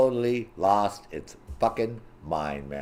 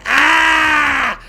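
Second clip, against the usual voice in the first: high, loud mock shriek of fright; girl's shriek; 8 dB louder than usual. Girl pulling away from Biden, shrieking in fright. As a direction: shriek like a scared little girl. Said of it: "pulling away like".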